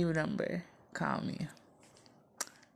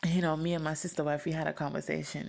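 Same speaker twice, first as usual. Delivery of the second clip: ordinary delivery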